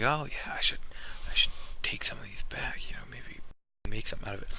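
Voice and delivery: imitating someone muttering calmly to himself. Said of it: "normally he mutters so calmly".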